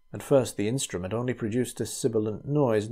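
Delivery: plain reading voice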